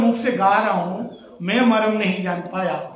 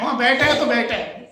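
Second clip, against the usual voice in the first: usual speaking voice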